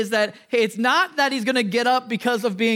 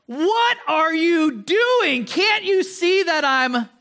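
high, drawn-out mock outrage; cranky; 7 semitones higher, much slower than usual. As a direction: act out a rudely woken dad, slow and high-pitched. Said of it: "get a little bit cranky".

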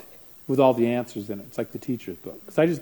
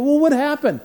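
much higher and louder, demanding; urgent question; 14 semitones higher, 9 dB louder than usual. Retold or imitated. Counselor asking the panicked mom what happened, urgent. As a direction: push for the story urgently. Retelling a frantic phone call.

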